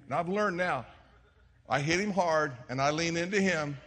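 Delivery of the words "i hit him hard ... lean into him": ordinary talk, nothing marked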